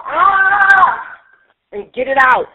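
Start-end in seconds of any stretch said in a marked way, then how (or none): 0.0-1.2 high, loud cathartic scream; release scream. Shown as a demonstration. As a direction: let out a raw scream. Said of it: "you can just go".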